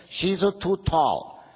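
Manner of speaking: put-on slow Italian-accented mamma; Italian accent, disapproving mother. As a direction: scold like an old Italian mother. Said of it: "my little Italian mother".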